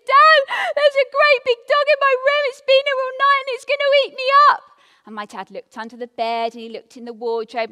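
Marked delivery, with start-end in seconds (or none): 0.1-4.6 put-on high, loud, fast scared little girl; frightened child. Little girl telling dad about the dog, high and frightened. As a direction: blurt it out like a terrified child.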